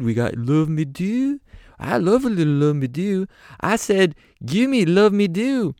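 high rocker voice. Retold or imitated. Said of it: "Slash is like".

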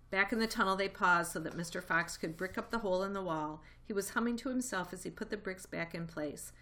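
ordinary delivery

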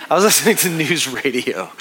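repeating it with a self-mocking laugh; laughing at himself. Real, not acted. Pastor laughing at his own old-man habit, amused. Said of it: "That makes me sound so old".

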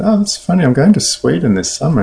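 light up at the coincidence, retelling it; retold pleasant surprise.

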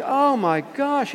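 slow, high-pitched appalled cry; hammed-up horrified reaction; mock horror. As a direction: draw it out in comic dread.

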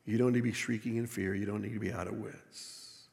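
low voice sinking toward a hush; gentle reassurance. Acted. God to followers shrieking in fear, hushed reassurance.

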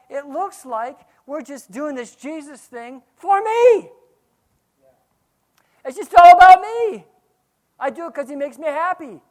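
high, loud, sneering voice of a selfish believer; hammed-up me-me-me complaint; mock self-centred whine. Preacher mocks believers who make it about me, loud and high.

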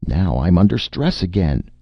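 frantic complaint; wild. A narrator's reading. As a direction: cry out in rising panic. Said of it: "Martin said wildly".

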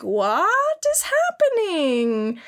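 put-on high, slow girly voice; slow high mock bewilderment; mock anime girl. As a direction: draw it out like a confused anime girl. Voicing the girl in the hentai.

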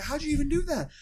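high, loud exasperated cry; exasperated shout. Retold or imitated. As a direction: yell in disbelief at the mess. Dad exasperated at spilled tea.

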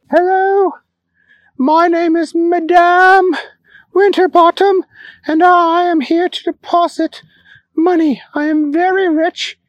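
high, haughty society lady; grand rich lady. Acted. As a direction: speak as a pompous rich madame.

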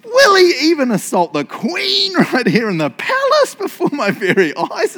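high, loud, scandalised royal roar; theatrical indignant king; outraged exclamation. Acted. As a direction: declaim it in hammed-up disbelief. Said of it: "The king exclaimed".

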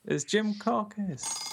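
dramatic winner announcement. Acted. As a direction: stretch it out like a game show host. Announcing the game winner, repeated.

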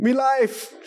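high, badly faked Australian; hammed-up Australian accent, same as all the others; Australian accent attempt. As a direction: squeal it in mock Australian.

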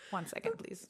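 a hushed, half-whispered pause request; hushed aside. Pretend. Imagined client interrupts meditation, answered in a hushed mock voice. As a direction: quietly hold off the client.

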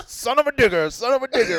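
high, loud announcer call; monster truck ad. Retold or imitated. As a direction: boom it like an arena announcer. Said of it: "you keep hearing".

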